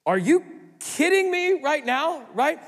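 loud, high, slow disbelief; are you kidding me, thrown at the crowd; incredulous outburst. Real, not acted. Preacher to congregation, loud incredulous disbelief. Pastor exasperated at Pharisees' request.

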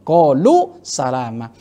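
slow, recited like Quran; recited Arabic. Acted. Preacher quoting the verse, slow recitation. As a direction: intone the verse slowly.

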